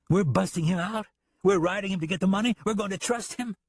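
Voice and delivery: high, pressing disbelief; can't believe what he hears; disbelieving. Acted. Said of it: "looking at him in disbelief".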